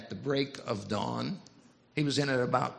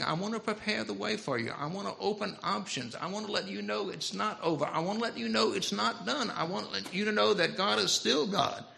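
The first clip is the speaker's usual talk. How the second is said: higher-pitched, insistent assurance; earnest proclamation; 7 semitones higher than usual. Acted. Preacher voices John to the congregation, earnest and higher-pitched. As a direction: press each promise home in a raised pitch.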